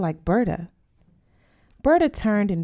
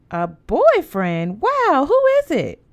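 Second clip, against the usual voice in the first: much higher and louder, thrilled; excited surprise; 8 semitones higher, 6 dB louder, slower than usual. A narrator's reading. Sister hearing about the boyfriend, excited squeal. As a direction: squeal it out in delight.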